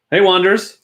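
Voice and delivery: normal talking voice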